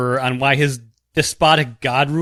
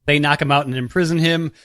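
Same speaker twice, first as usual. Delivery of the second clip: normal talking voice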